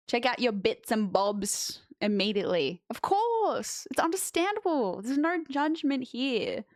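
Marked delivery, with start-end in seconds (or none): 2.9-6.6 high, sugary mock reassurance; mock sympathy. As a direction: pretend to be understanding, pitched up. Mock understanding reply to chat.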